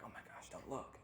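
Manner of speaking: urgent whisper; whispered warning. Retold or imitated. Family warning each other in church, whispering. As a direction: hiss it at the family.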